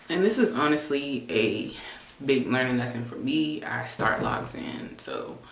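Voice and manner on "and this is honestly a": ordinary talk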